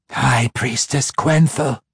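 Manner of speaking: slow, lightly voiced serpent; a hint of a hissing snake head; snake's voice. A narrator's reading. Scourge snake rebukes its mistress, lightly voiced serpent. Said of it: "It came from one of the heads".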